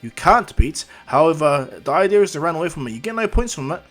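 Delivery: loud announcer. Acted. Announcer explains the zero pointer to examinees, loud projection.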